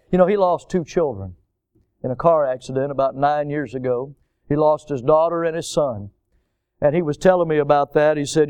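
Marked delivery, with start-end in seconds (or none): none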